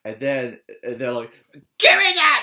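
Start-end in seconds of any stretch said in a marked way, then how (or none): 1.8-2.4 loud, high, greedy yell; snatch it with a shout; grabby shout. Retold or imitated. Vlogger acting out a kid grabbing candy with a shout.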